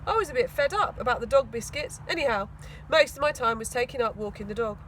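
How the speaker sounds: ordinary delivery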